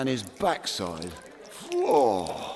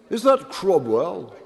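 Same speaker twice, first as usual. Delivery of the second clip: put-on drunk patron voice; 7 semitones higher, 3 dB louder than usual. Pub patron asks about Cromwell.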